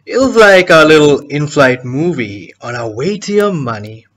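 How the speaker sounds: deliberate, taunting stage monologue; cocky villain explaining the plan; gloating schemer. Acted. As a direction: lay out the trap with relish, pausing between moves. Performs the schemer's monologue from a play.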